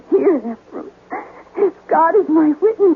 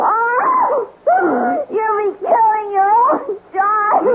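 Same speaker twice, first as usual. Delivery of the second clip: tearful plea; 9 semitones higher, slower than usual. Acted. Pleading for her child.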